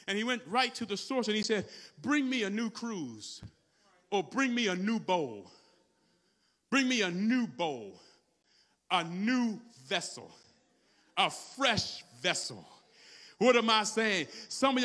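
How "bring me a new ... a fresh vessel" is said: man of God's command. Acted. Man of God asks for a new vessel, slow solemn command.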